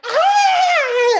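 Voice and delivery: high, loud imitated scream; freaked-out scream. Kids seeing an owl swoop past, acted freaked-out scream. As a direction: scream like a startled kid. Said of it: "freaked out".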